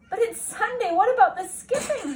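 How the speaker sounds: little brother calling after his sister, high; whining little brother. Acted. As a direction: protest like a disappointed little boy. Brother's complaint.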